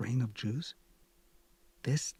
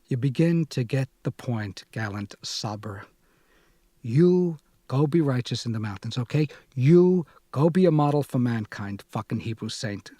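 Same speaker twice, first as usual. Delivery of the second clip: angry tirade; 8 dB louder than usual. Acted. Furious lover's tirade.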